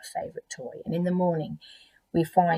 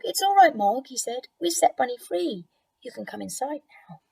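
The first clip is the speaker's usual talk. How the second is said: gentle reassurance; 7 semitones higher than usual. Acted. Nicky reassures Mog.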